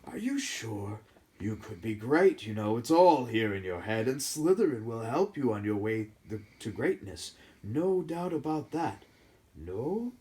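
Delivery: lightly voiced slow persuading hat; hat tries to sway Harry; small hat voice. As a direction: tempt him, then give in. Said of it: "said the small voice".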